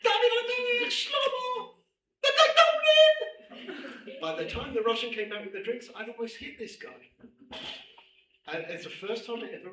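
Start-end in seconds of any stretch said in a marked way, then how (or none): 0.1-4.2 high, very loud sung-out scream; screaming the names. Retold or imitated. The speaker screaming back at the Lakewood man, singing out names. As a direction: bellow each name like a battle cry. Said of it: "I screamed".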